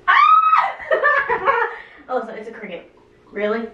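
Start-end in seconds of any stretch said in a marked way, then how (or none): 0.2-1.8 shrieking laugh. Real, not acted. Scream-laugh at cricket.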